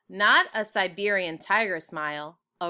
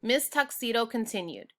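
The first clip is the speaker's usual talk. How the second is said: plain reading voice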